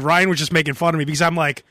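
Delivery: ordinary talk, nothing marked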